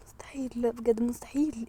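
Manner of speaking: soft unvoiced disbelief; whisper. Real, not acted. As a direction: hush it so nobody hears. Reading a secret, whispering.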